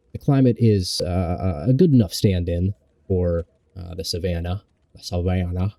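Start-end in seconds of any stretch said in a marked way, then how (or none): 4.9-5.7 lightly mocking southern accent. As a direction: say it like a genteel Southerner. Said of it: "I was doing a southern accent there".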